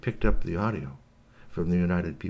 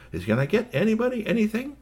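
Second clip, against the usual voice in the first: very high polite offer; high, eager-to-serve host; helpful host. Acted. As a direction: ask it in a high-pitched character voice. Storyteller voices the host.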